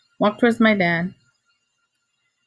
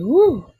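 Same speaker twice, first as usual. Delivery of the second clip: high, louder cry of relief; relieved woo; 8 semitones higher, 6 dB louder than usual. Retold or imitated. Cop drives off, a high relieved woo. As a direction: let it out like you got away with it.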